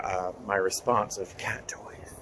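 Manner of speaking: slow soft whisper. Real, not acted. Telling viewers the punchline, whispered. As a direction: tell them the secret under your breath. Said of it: "whispered aside".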